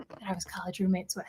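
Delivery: fast whispered aside; whispering. Real, not acted. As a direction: let it slip in a whisper. Aside about the roommates.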